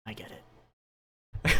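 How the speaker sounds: breathy whispered aside. Acted. Riddle answering Mary's jab, slow whisper. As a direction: whisper it slow, almost to yourself. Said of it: "Riddle looks at her and goes".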